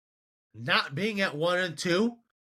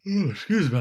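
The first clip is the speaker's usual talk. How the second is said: talking through a yawn; stretched out mid-yawn; 3 semitones higher, faster than usual. Real, not acted. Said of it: "I can't stop yawning".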